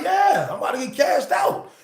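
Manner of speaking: hammed-up shout; retold shout. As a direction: call it out with exasperation. Announcing he is leaving.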